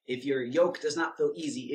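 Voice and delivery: ordinary delivery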